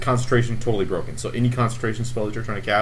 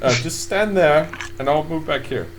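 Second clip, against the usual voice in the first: nervous NPC; 7 semitones higher than usual. Acted. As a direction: back away politely. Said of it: "he says".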